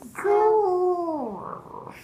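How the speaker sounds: high loud puppy growl. Pretend. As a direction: yap out a little growl. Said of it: "puppy growl".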